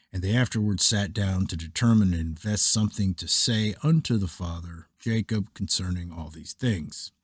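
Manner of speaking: usual speaking voice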